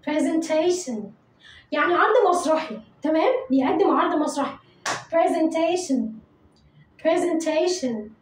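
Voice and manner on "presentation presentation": slow model word. Shown as a demonstration. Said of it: "قول ورايا".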